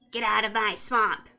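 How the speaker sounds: loud, raspy little creature; scraggly frog voice. A narrator's reading. Frog answering the adventurer who questioned it, loud scraggly voice. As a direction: rasp it out loudly as a scraggly frog. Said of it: "small, kind of scraggly voice".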